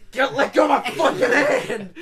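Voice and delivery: high, very loud pained demand; hammed-up shout to let go; frantic yell. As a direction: yell it like your hand is being crushed. Retold reaction to painful handshake grip.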